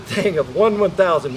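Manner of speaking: usual speaking voice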